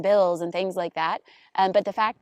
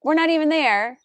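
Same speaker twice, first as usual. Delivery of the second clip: much higher and louder, bursting with amazement; excited disbelief; 9 semitones higher, 8 dB louder than usual. Real, not acted. Host marvels that neighbours decorated while she was out, excited disbelief. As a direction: gush with astonished delight.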